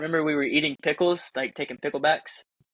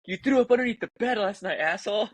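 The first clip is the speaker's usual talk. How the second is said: higher, accusing blame; mock scolding; 7 semitones higher than usual. Friends blaming him for vomiting, mock angry. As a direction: scold him like a disgusted roommate.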